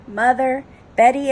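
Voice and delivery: plain reading voice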